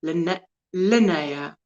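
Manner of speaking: slow, soft attempt at Linnea as "lin-NAY-a"; practised pronunciation. Shown as a demonstration. Host practising a viewer's name, Linnea slowly said as "lin-NAY-a". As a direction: sound out Linnea with a "nay" middle. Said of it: "emphasis on".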